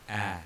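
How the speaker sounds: "A" as the open vowel of "bat". Shown as a demonstration. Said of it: "letter A in the word bat".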